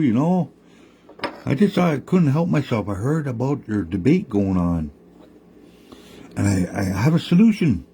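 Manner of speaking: calm the frogs while setting the trap; high, eager sly coyote pitch; smooth-talking Coyote. Acted. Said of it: "Coyote's there saying".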